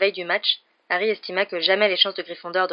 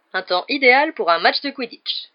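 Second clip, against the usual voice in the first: a higher, louder, eager cry; a captain thrilled at the perfect weather; bursting with match-day excitement; enthusiastic exclaim; 5 semitones higher, 7 dB louder than usual. Acted. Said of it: "s'exclama Dubois avec enthousiasme".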